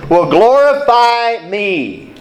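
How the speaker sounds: slow, drawn-out "will glorify me" with weight on "me"; emphatic me. Shown as a demonstration. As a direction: lean hard on "me". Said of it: "the word "me" in that verse is emphatic".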